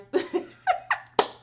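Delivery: high, breathy burst of laughter. Real, not acted. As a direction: break into a real laugh.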